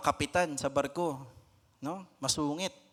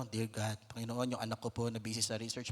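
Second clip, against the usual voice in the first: hushed, lower prayerful murmur; soft prayer; 5 semitones lower, 7 dB quieter than usual. Acted. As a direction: pray softly for your child. Parent quietly prays for child. (mahinang panalangin)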